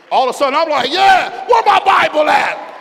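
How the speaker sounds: loud, high, suddenly fired up; eager preacher's holler; fired-up shout. Retold or imitated. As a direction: shout it like the spirit just hit you. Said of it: "perked up in the spirit".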